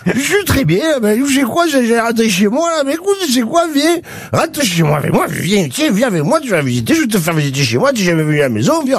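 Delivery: put-on high, slurring, overeager drunk; drunk friend. Wasted man pushing his friend to come home, drunkenly. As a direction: gush like a wasted guy inviting his pal home. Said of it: "complètement bourré, là, il fait".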